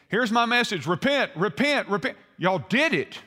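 high, loud mock preaching, indignant at the end; dysfunctional preacher. Jonah preaching to Nineveh and resenting the result, mock preacher. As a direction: hammer out the sermon in comic frustration. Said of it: "that's a dysfunctional preacher".

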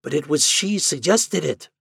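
high-pitched protest; crying out. A narrator's reading. Doctor contradicting Ford, crying out in protest. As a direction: cry it out in objection. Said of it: "cried the doctor".

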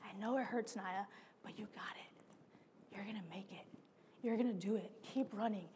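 soft, low encouragement; reassuring friend. Retold or imitated. Friend running beside her, soft and reassuring. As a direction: gently coax her to keep going. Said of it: "And he goes".

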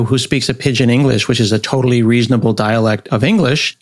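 ordinary talk

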